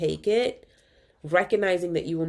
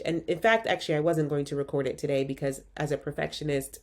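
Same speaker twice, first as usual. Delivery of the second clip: normal talking voice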